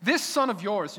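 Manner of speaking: "This" hit with contempt, distancing; older son refusing to say brother; scornful stress on this. Acted. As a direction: spit out "This son of yours" coldly. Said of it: "he doesn't even want to call him his brother".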